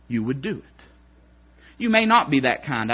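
ordinary delivery

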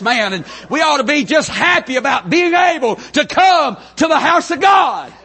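high-pitched, building sermon delivery; impassioned preaching. Real, not acted. Preacher urges congregation to be happy in church, impassioned preaching.

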